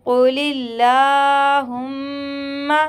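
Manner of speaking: कुलिल्लाहुम्मा with ला stretched and a nasal hum on म्मा; tajweed demo, stretched la, nasal mim; lengthened la and ghunna. Shown as a demonstration. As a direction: draw out ला and hum the doubled मीम in कुलिल्लाहुम्मा. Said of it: "ला को खींचेंगे और मीम मुशद्दद का गुन्ना करेंगे".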